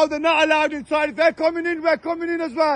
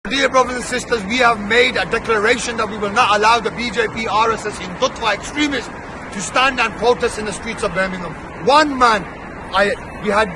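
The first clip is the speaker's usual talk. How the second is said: lower, declaiming to the crowd; formal protest speech to supporters; rally speech; 5 semitones lower than usual. Real, not acted. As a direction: rouse the listeners like a street orator.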